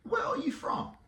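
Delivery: high, put-on woman's voice; imitated new hire. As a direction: mimic her question in a high pitch. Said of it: "And she says".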